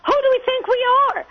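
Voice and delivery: high indignant cry of disbelief; outraged rhetorical question. Real, not acted.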